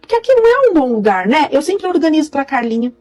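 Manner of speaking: mother-in-law sweetly explaining why she moved the pans; smiling mother-in-law. Retold or imitated. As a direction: smile through it like a sweet meddler. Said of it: "sorrindo, como sempre".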